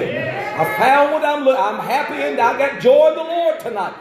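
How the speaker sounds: high, loud joyful shout. Real, not acted. Pastor rejoicing before the church, shouting high. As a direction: shout it out with joy. Peak of the sermon.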